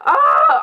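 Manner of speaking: loud, high exasperated "oh"; high, loud cry of frustration; frustrated wail. Real, not acted. Frustrated at failing to pronounce name.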